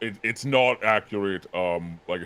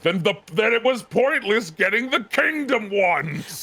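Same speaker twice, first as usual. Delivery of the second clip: high-pitched, hammed-up griping; salty complaint; 11 semitones higher than usual. Collector imitating their own gripe about toys, salty and high. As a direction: whine it, mocking your own saltiness. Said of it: "me being salty and being like".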